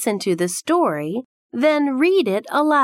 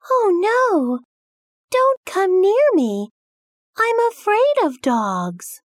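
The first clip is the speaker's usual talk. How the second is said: put-on scared child; 11 semitones higher than usual. Child afraid of the dog.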